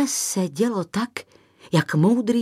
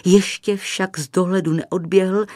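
plain reading voice

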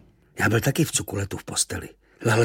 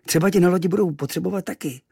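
quick, high eager invitation; coaxing; 8 semitones higher, 4 dB louder, faster than usual. Acted. Hero eagerly inviting Kvíkvék along to the ship. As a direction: talk him into coming.

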